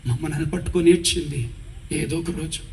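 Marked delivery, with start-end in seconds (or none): 1.9-2.6 hushed, much softer grieving words; a retold, quiet sorrowful lament; tearful mother. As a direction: murmur it over the child through tears. Mother weeping over her son.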